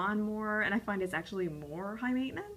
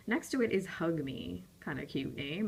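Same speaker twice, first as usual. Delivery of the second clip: normal talking voice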